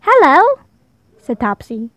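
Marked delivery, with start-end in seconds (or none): 0.0-0.6 put-on high, eager little girl's hello; bright child voice. A narrator's reading. Topsy greeting a new friend, high and eager. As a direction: greet like a cheerful small child.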